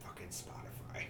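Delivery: whispered mock curse; whispering. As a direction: hiss it under your breath. Quoting own annoyed thought.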